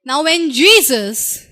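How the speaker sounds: ordinary talk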